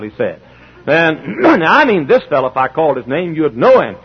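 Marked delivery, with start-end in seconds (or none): none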